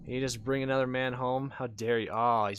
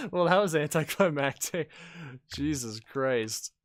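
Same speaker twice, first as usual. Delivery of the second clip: ordinary talk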